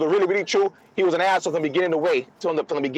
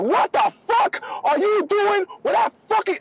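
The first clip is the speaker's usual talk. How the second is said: high, slow, imitated furious shout of mom; mom cussing out; slower than usual. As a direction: yell it like an outraged mother at the airport. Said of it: "she cussed me out".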